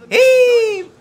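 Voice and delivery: loud high triumphant whoop; loud, high yell of joy; excited whoop. Real, not acted. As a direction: let out a big victory yell. Whoop after escaping.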